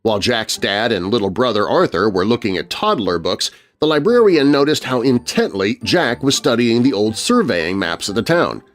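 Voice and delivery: ordinary delivery, nothing marked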